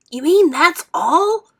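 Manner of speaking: high, loud, slow scornful disbelief; disgusted. A narrator's reading. Mike sneering at the tiny gum, disgusted and loud. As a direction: sneer it out, appalled it's so small.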